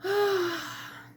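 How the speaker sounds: high, drawn-out sad sigh; long sigh. A narrator's reading. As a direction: sigh with loneliness. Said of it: "and sighed".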